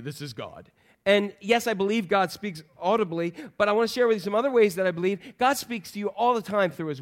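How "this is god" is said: much lower, softer booming announcer voice; James Earl Jones impression. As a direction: drop deep and quiet like a famous voice saying the network name. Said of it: "You know, like".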